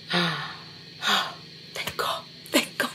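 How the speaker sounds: breathless gasp, then a whispered "Thank God"; whispered relief. Real, not acted. Checking steps app, relieved.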